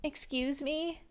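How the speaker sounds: high-pitched, incredulous character voice; hammed-up high voice; put-on heroine voice. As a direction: squeak it out as a baffled heroine. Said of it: "I don't know why I'm doing these voices".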